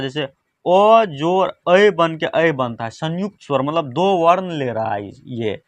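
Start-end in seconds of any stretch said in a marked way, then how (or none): 0.2-2.9 slow and deliberate, spelling out each sound; walk the class through it slowly; patient teacher's slow demonstration; slow vowel demo. Shown as a demonstration. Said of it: "ऐ कैसे बनता है?".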